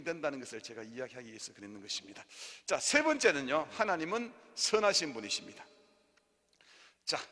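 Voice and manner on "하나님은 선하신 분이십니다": preaching. Real, not acted.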